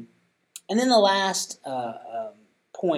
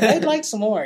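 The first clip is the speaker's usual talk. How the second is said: put-on higher, louder little kid asking for more; child's reply; 9 semitones higher, 9 dB louder than usual. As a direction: pipe up like a kid who wants more hugs. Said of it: "sometimes they're like".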